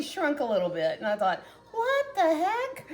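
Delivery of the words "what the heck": high, drawn-out mock disbelief; recalled amazement, pitched way up; baffled surprise. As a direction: stretch it out high, acting baffled. Acting out her surprised thought.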